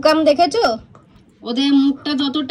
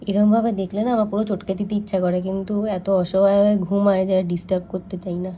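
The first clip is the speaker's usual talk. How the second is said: hushed, softer voice so the dogs keep sleeping; speaking softly; 5 semitones lower, 6 dB quieter than usual. Real, not acted. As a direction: keep it low beside the sleeping pups. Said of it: "আমি আস্তে আস্তে কথা বলছি".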